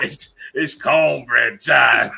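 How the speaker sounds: slow, loud, laughing catchphrase; laughing shout. Pretend. Joking with the live chat, a slow laughing shout. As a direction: bellow the catchphrase through laughter.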